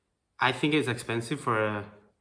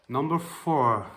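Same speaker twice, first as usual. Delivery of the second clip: announcer voice; 3 semitones higher, 7 dB louder, slower than usual. Real, not acted. Countdown announcement.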